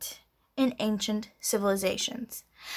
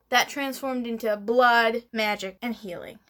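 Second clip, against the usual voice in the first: normal talking voice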